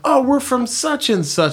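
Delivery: high-pitched mock tourist reply; perky, exaggerated introduction; chirpy small talk. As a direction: imitate overeager Americans abroad. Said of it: "They'd be like".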